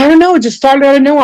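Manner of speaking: playful high-pitched retelling; imitating a friend. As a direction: squeak out the friend's answer. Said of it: "She goes".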